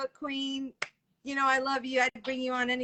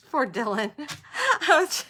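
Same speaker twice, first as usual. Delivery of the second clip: laughing; 5 semitones higher, 4 dB louder than usual. Real, not acted. Host laughing at Dylan's mishap.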